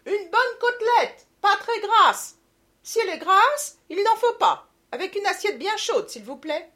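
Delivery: high, loud call from far off; calling across the room. A narrator's reading. Mother calling her order from the back of the room. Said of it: "du fond de la salle".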